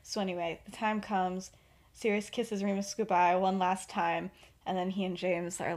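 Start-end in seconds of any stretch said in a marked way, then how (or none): none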